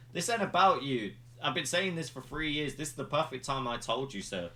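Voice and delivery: Roman boasting. Retold or imitated. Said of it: "goes like".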